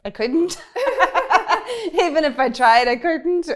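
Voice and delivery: high, loud, through real laughter; laughing admission. Real, not acted. Asked about modifying her accent, laughing admission. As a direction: laugh helplessly while admitting it.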